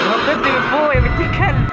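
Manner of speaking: high, loud yell at the jumping monster; startled shout. Real, not acted. Gamer yelling at a creature that jumped down at him. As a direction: scream it out in real shock.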